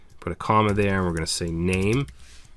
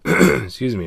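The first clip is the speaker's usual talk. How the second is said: hoarse throat clear, then apology; 6 semitones higher, 6 dB louder than usual. Real, not acted. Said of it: "I'm losing my voice".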